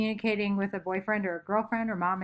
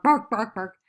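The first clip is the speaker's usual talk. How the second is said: louder mock bark bark bark; playful dog barking sound; dog bark imitation; 5 dB louder than usual. Shown as a demonstration. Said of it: "if you hear a dog bark".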